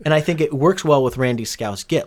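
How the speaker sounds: ordinary talk, nothing marked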